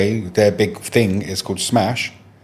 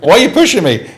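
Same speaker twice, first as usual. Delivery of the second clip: louder, raised-pitch bewildered protest; retold indignant protest; 5 semitones higher, 6 dB louder than usual. Customer to people blocking him at the salon, bewildered protest. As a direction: act out being jostled and baffled. Acting out his protest when shoved.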